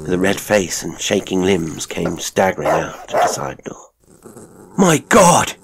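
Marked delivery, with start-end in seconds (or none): 4.8-5.5 high, loud exclamation of alarm; horrified cry. A narrator's reading. Elderly man staggering out to a mauling, horrified cry. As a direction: cry out in sudden horror. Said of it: "he cried".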